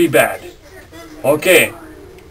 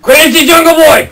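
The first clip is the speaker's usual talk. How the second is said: calling from far away; 6 semitones higher than usual. Real, not acted. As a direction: shout his name to bring him over. Calling the boy over.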